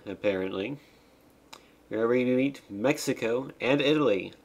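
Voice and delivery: ordinary talk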